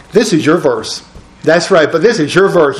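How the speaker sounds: ordinary talk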